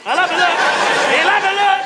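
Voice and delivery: rising loud eager repeats; eager shouting. Retold or imitated. Offered cocaine, he eagerly yells acceptance.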